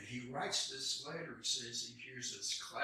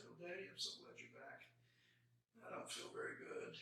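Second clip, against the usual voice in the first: put-on soft, weak small-child voice; hushed weak child to her daddy; sick little girl; 5 semitones higher, 10 dB quieter than usual. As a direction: fade out like a feverish child. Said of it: "she says".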